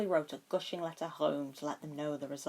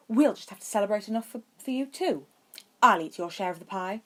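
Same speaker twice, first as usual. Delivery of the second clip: quicker and louder, breezy cheer; upbeat; 4 semitones higher, 7 dB louder than usual. A narrator's reading. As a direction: brush the gloom aside with bravado. Draco cheering Harry up.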